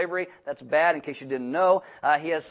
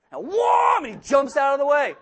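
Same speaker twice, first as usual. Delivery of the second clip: excited shout; 8 semitones higher, 6 dB louder than usual. Retold or imitated. Retelling revving at pedestrian.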